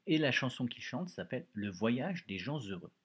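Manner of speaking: ordinary talk, nothing marked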